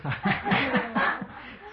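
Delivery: high, breaking-out laugh. Real, not acted. Speaker amused while telling of the drunk man, laughing. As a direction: burst out laughing mid-sentence.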